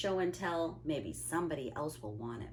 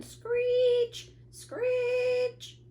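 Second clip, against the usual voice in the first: very high, loud drawn-out screech; piercing scrape at a window; screeching noise; 7 dB louder than usual. A narrator's reading. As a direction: shriek the word like the sound itself.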